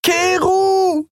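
very high, drawn-out call; desperate scream. A narrator's reading. Andriel calls after departing Keru, screaming. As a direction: scream the name after someone leaving. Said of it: "schrie sie".

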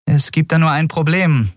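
lightly voiced captain, high; young captain. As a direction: break it to him gently. (Kapitänin, sachlich)

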